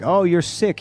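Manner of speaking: lightly voiced doctor, pitched high; mock doctor. Doctor handing a sick wrestler antibiotics, mock doctor.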